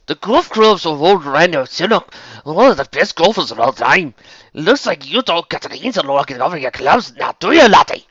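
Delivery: loud, high-pitched thick Scots brogue; thick Scots accent. Acted. Villain gloating over a golfer's clubs in the museum, thick Scots accent. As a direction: crow it in broad Scots. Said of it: "said in a thick Scots accent".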